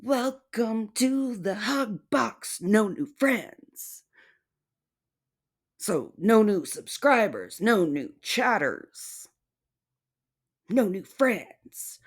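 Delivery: high, slow sing-song sarcasm; mock mantra. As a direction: chant it like a fake rule.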